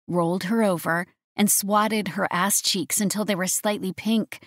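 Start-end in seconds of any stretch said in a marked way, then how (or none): none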